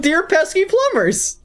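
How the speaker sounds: high-pitched mock Bowser line; Bowser impression. Proving his voices differ, high hammed-up Bowser. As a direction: squeak it out like a cartoon villain. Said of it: "One starts with".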